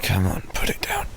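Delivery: whisper. Acted. Girlfriend in bed coaxing her partner off the phone, whispered. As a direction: breathe it, coaxing them to bed.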